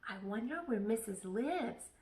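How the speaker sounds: ordinary delivery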